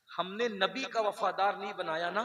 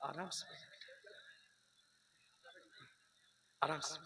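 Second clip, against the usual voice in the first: much softer, low and hushed; soft calming; 6 semitones lower, 17 dB quieter than usual. Real, not acted. Preacher hushing the restless congregation, soft and low. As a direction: quietly settle the crowd down. Preacher calms the crowd off-mic.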